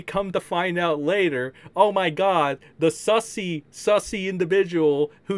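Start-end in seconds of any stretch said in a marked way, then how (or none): none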